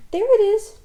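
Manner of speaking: high, loud excited spotting; young Roo spotting something. A narrator's reading.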